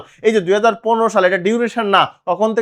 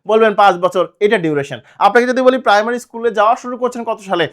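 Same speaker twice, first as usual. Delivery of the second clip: usual speaking voice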